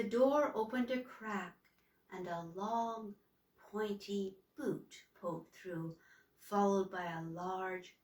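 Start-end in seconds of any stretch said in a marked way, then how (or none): none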